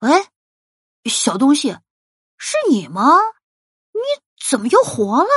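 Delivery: incredulous shark voice; disbelieving. Acted. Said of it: "不相信地问".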